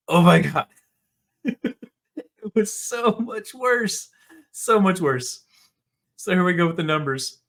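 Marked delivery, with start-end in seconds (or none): 0.1-5.4 laughing. Real, not acted. Laughing at the awful box office.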